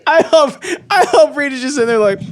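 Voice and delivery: high-pitched talking through laughter; laughing. Real, not acted. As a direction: barely get it out while cracking up. Cracking up over the prank.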